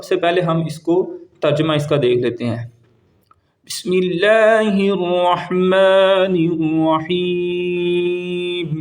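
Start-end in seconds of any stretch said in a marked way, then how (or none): 3.7-8.7 very slow, drawn-out tajweed chant; slow devotional recitation; Quranic recitation. Shown as a demonstration. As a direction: recite each word stretched and melodic. Recites the surah before translating.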